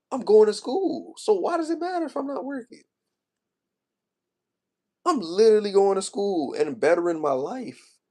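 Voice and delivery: high, strained voice close to tears; upset, pitch climbing high in protest; emotional. Real, not acted. Defending himself to critics about school, emotional. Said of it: "I'm about to get emotional".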